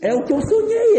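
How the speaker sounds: thanking the Lord in rising delight; delighted man. Acted. Man thanks God for the woman, rising ecstatic voice.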